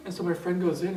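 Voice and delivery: usual speaking voice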